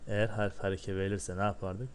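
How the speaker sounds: normal talking voice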